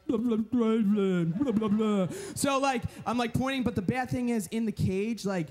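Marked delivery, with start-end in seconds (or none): 0.1-2.1 loud muffled blah through a snorkel; underwater babble. Retold or imitated. Man in the shark cage trying to talk through his snorkel, muffled and loud.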